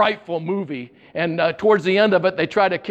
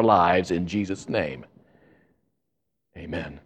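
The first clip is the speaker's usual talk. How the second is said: earnest prayer; 7 semitones lower than usual. Real, not acted. Opening prayer.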